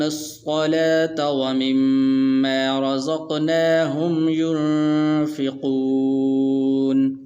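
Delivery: very slow melodic chant; Quran recitation. Shown as a demonstration. Teacher reciting the verse to students in slow melodic tajweed. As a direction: sing out the verse slowly, stretching the vowels.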